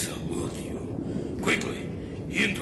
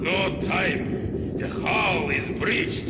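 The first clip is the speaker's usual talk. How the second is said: put-on loud shout with a Russian accent; urgent shout; 6 semitones higher, 4 dB louder than usual. Admiral warning Lara the hull is breached, Russian-accented shout. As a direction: shout the alarm as the hull gives way. Ship flooding, urgent alarm.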